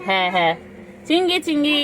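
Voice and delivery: high, loud, sing-song calling to a pet. Real, not acted. Owner calling her sleepy pet Chingri, loud and high.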